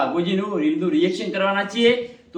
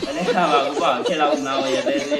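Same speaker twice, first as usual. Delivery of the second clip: talking through real laughter; laughing; 3 dB louder than usual. Real, not acted. Reacting to Vaghuba's punchline, laughing. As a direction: let the laugh spill into the words.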